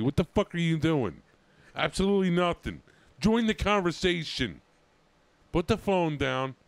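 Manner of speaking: loud, high teasing scold. Real, not acted. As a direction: tell him off to put the phone down. Scolding friend on his phone.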